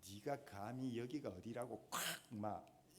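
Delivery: mock Gyeongsang rebuke, kept quiet; a light Gyeongsang dialect scolding; 경상도 사투리로 꾸짖듯이. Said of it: "경상도 말로 표현을 하면 이런 분위기예요".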